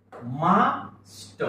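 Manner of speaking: मास्ट split into rising "maa", then "s-t"; sounded out slowly. Shown as a demonstration. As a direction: break मास्ट into slow separate sounds. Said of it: "शब्द काय झाला?".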